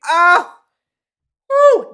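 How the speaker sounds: loud, high "oh, woo"; shocked outcry. Real, not acted. Recapper reacting to fighting words, shocked outcry. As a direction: whoop it out at the insult.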